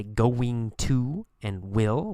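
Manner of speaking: slow, separated "going to and will"; formal full forms of "going to" and "will"; careful full pronunciation. Shown as a demonstration. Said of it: "We change the pronunciation".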